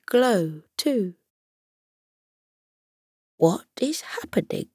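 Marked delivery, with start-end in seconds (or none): 3.4-4.7 fast, unvoiced croaking whisper; croaky whisper. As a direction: croak it out, sick and surprised. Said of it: "the hedgehog croaked".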